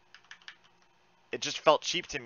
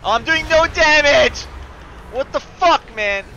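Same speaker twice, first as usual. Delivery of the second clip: louder and higher, exasperated; frustrated shout; 12 semitones higher, 11 dB louder than usual. Real, not acted. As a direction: yell at the damage meter. Sees his low damage.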